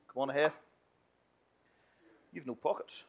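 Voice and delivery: ordinary delivery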